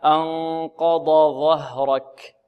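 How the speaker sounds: أنقض ظهرك very slow, ض then ظ distinct; correct tajweed model. Shown as a demonstration. Teacher giving students the right version, slow distinct letters. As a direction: draw out أنقض ظهرك, separating ض from ظ. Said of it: "هكذا".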